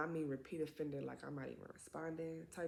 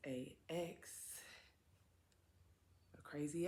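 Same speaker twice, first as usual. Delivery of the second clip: whisper; 4 dB quieter than usual. Real, not acted. Introducing her crazy ex.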